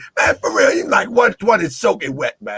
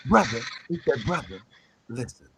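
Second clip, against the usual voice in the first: low, soft voice, barely above a murmur; imitating soft talk; 8 semitones lower, 8 dB quieter than usual. Braswell Bey calming the visiting brother: mock soft murmur. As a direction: hush it like a careful elder. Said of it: "they talk real soft".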